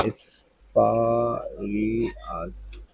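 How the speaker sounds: quiet, distant playback; dictionary audio playing. Real, not acted. Teacher checks স্পারিয়াস. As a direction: let the word play softly. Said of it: "spurious না স্পারিয়াস".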